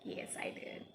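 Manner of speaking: a hushed unvoiced "Yes, I did"; whisper. Real, not acted. Mother whispers back to her son, admitting it.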